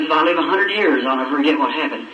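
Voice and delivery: ordinary talk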